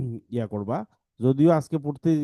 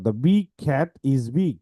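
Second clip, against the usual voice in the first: slow reading-aloud demo; much slower than usual. Shown as a demonstration. Teacher slowly reading a sample sentence to students. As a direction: read "the big cat is big" word by word, slowly. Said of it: "এই দেখো".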